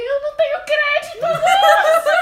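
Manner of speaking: very high, loud mock wail; retold desperate shout, stretched into a wail; hammed-up crying. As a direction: plead in an exaggerated sob, breaking into laughter. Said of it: "eu começo a chorar".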